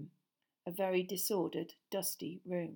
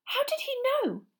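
high amazed question; amazed girl's voice; 4 dB louder than usual. A narrator's reading. Hermione asking Harry about her cat, high amazed. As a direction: wonder aloud in disbelief.

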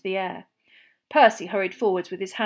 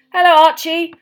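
higher, louder cheery hellos; calling greetings; 8 semitones higher, 15 dB louder than usual. Acted. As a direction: hail them as they pass. Mr Weasley greeting passers-by.

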